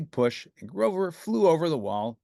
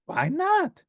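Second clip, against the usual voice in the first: puzzled Grover; 4 dB louder than usual. A narrator's reading.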